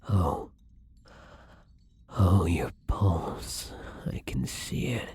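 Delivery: breathy, entranced whisper as bloodlust takes over; hungry whisper. Acted. New vampire losing control over the captive blood bag, hungry whisper. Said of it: "that wasn't me talking. I lost control".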